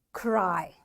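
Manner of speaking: "Cry" low, ending in a drawn-out long I; long I sound. Shown as a demonstration. Teacher shows pupils the Y rule, long I drawn out. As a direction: stretch the vowel of "Cry" as a long I. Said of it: "Long I sound".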